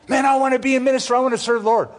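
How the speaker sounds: mimicking a keen would-be minister, high and fast; eager imitation.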